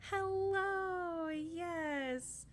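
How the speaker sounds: high, delighted drawn-out hello; excited greeting. Real, not acted. Streamer welcomes Lady Dragonflame to the chat, excited. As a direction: greet them in a high, gleeful rush of welcome. Greeting a viewer who arrives.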